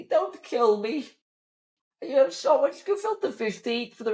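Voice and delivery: put-on high, whiny pleading old hedge fund guy; wimpy curmudgeon. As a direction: squeak out a panicked plea.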